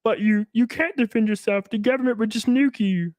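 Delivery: high-pitched mimicry of naysayers; mocking objectors. Imitating people who say self-defence is pointless, high mocking voice. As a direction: parrot the objection in a high put-on voice. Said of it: "then people like to say".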